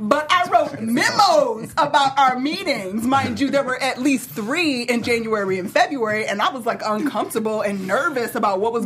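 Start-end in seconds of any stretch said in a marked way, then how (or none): 0.0-3.0 slow, sassy high voice. Acted. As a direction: drag it out with attitude. Acted sassy Comey quote continues.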